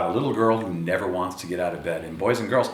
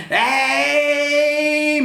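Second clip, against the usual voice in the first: high, loud sung-out yell; 6 dB louder than usual. Acted. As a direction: call up the stairs as loud as you can. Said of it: "yelled as loudly as he could".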